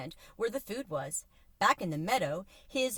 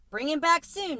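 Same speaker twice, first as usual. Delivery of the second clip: raised, higher call after someone leaving; calling after them; 9 semitones higher, 5 dB louder than usual. A narrator's reading. Counterman calling after a boy heading off, loud call. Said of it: "Mickey called after them".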